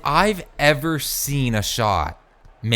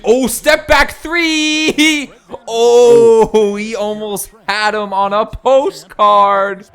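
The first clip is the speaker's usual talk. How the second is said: excited call; 8 semitones higher, 10 dB louder, slower than usual. Real, not acted. Excited call of a step-back three.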